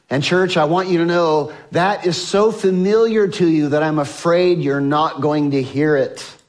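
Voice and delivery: normal talking voice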